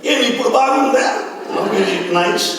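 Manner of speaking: high, loud, fast teasing voice; playful teasing question. Retold or imitated. Swami teasing the speaker from the swing, high and fast. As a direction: tease him from the swing.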